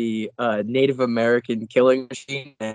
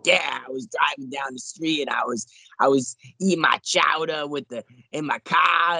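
put-on higher-pitched thick Boston accent; 5 semitones higher than usual. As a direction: lean hard into Boston. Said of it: "lean into the accent".

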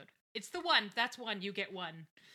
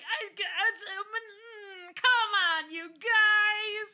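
sputtering into a high, loud whine; mock tantrum, building to a wail; whiny frustration; 13 semitones higher, 13 dB louder, much slower than usual. Brown pleading with the mob to come on, whiny.

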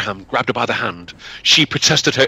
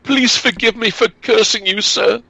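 high, pleading girl in mock tears; frightened girl begging the peddler; tearful plea; 11 semitones higher than usual. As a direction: beg forgiveness, a touch of crying. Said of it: "burst into tears and begged forgiveness".

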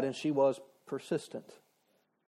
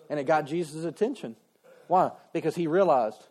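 usual speaking voice

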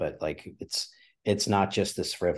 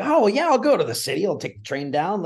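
much higher, louder, airy self-mocking; breezy mock nonchalance; 11 semitones higher, 7 dB louder than usual. Guest mocking his younger self's casual plan, breezy and higher. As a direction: toss it off like it's no big deal.

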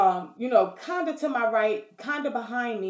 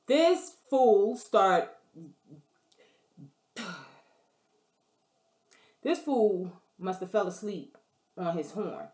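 ordinary delivery

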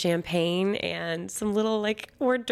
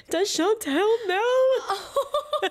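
much higher, slow, laughing; giddy question; 3 dB louder, much slower than usual. Retold or imitated. Asking her new fiancé about her best friend, giddy laughing. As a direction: stretch it out in a high laugh. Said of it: "I was like".

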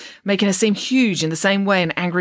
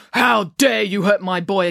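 slow, raised accusing growl of outrage; angry accusation; 4 semitones higher, 3 dB louder than usual. A narrator's reading. Father turning on the boy he grabbed, angry accusation. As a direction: accuse slowly, pitch lifted. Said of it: "accused Mister Wirtz".

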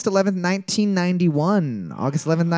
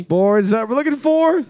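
high, loud quiz host call; announcer call; 5 semitones higher, 4 dB louder than usual. Acted. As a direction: boom it out like a game show host. Host announces answer reveal.

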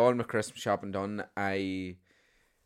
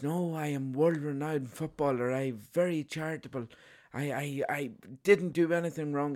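put-on higher, protesting French accent; botched French accent; 6 semitones higher than usual. Footballer defends himself to the saint, indignant French accent. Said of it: "German accent there".

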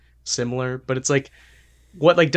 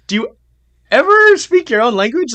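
much higher, louder, on ever; exasperated mock question; 11 semitones higher, 8 dB louder than usual. Repeats his question to English-speaking Scandinavians, exasperated and louder. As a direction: throw up your hands in disbelief. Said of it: "I'm like".

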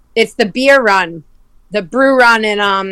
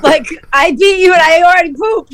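talking through real laughter, pitched up; laughing hard; 8 semitones higher, 4 dB louder than usual. Real, not acted. Retelling the race punchline to Paul, laughing through it. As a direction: laugh through every word.

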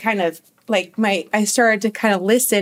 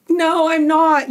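high-pitched mock crying protest; imitated tearful denial; 8 semitones higher, 3 dB louder than usual. Crying daughter answers her nervous mom, imitated tearful denial. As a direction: protest as a crying teen, higher, without sobs. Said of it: "still crying".

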